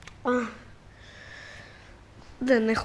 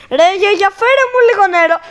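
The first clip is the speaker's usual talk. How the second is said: calling out; 9 semitones higher, 10 dB louder, faster than usual. Real, not acted. Calling grandma for water.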